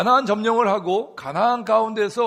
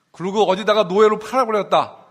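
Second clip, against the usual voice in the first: louder, punching the joke's end; mock punchline, loud; 3 semitones higher, 8 dB louder than usual. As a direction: deliver the punchline with a burst of force. Punchline of the joke.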